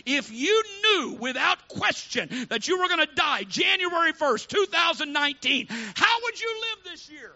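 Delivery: loud, urgent sermon voice; preaching at full force. Acted. Preacher urging the congregation to act now, loud and urgent. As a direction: drive the urgency home.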